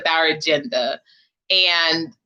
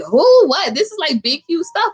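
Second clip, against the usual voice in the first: excited disbelief; 8 semitones higher than usual. Retold or imitated. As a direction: retell her amazed outburst. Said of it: "Monica was like".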